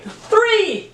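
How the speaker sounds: high-pitched enthusiastic exclamation. Shown as a demonstration. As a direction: call out the number like an exclamation point, pitch soaring. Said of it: "say it with enthusiasm".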